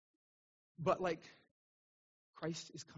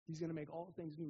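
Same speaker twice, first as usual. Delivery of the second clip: normal talking voice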